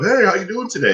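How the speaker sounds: high-pitched mock salesman greeting; Roger. Customer mimics salesman greeting him at the suit store, high mocking voice. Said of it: "fucking Roger walks up".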